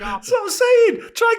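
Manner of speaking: high, drawn-out outburst; exasperated agreement. Real, not acted. Cat owner vindicated by friend's example, high exasperated cry.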